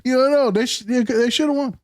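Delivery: high-pitched mock sympathizer; pitched well up, tongue-in-cheek; sarcastic. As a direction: sarcastically play the regretful partisan. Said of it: "Sarcasm, people. Sarcasm".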